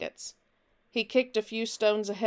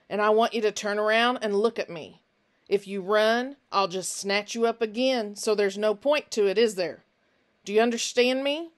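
put-on louder, gripping threat to a captured boy; menacing stranger Finch; 4 dB louder than usual. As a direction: snarl a warning while holding him tight. Finch commands Nick.

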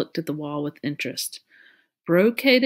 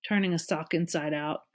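plain reading voice

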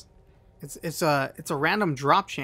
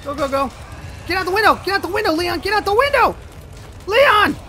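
high loud frantic commands; frantic yelling; 13 semitones higher, 4 dB louder than usual. Real, not acted. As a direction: shout at the screen to jump. Urging character to escape.

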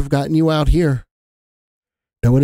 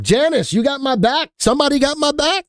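high, pleading cry for backup; 12 semitones higher than usual. Real, not acted. Host begging his chat for support, high and pleading.

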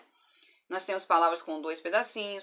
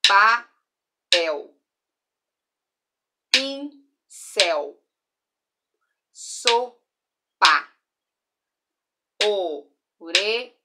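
"papel", "pincel", "sopa", "orelha" split and loud; syllable-clapped; 7 dB louder, much slower than usual. Shown as a demonstration. Teacher reviewing clapped words, loud. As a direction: clap out each word in syllables. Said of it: "batendo pra ouvir quantas vezes".

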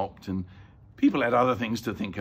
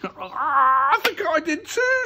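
high, loud, drawn-out quaver; frightened quivering wail; quivery voice; 7 dB louder, slower than usual. A narrator's reading. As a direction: stammer it out slowly, spooked and high. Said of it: "in a quivery voice".

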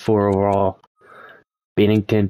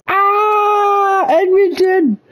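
much higher, louder shout of despair; anguished shout; 4 dB louder, much slower than usual. Real, not acted. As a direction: cry out as your team loses the cup. Edmonton loses the final.

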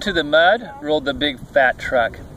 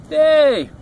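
high, loud happy yay; high storybook cheer; 11 semitones higher, 6 dB louder than usual. A narrator's reading. Animals cheer climbing aboard.

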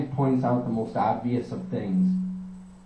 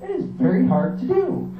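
high-pitched rant; 8 semitones higher than usual. Acted. Ranting about being himself.

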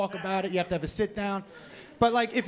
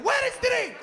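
high-pitched shouted demand, hammed-up accent; mock aggressive yelling; 13 semitones higher, 4 dB louder than usual. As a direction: yell it like an impatient foreign customer. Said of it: "they just fucking yell at you".

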